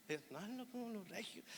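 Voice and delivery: very soft mocking regio echo; hushed, teasing Monterrey mimicry; mimicked regio accent. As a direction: parrot the denial under a lilting Monterrey accent. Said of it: "ese acento así como golpeadón, cantadito".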